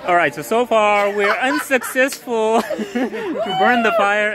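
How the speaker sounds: chuckling while admitting defeat; amused laugh-talk. Real, not acted. Laughing while reporting failed fire.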